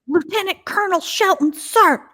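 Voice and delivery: high-pitched military bellow in imitation; mock officer's yell. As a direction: imitate a commander berating a recruit. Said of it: "yelling at him".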